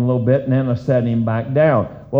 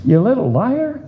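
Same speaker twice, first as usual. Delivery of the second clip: put-on higher, teasing scold to a toddler; playful shocked scolding, pitched up; high mock scolding; 9 semitones higher than usual. Father scolding his toddler again.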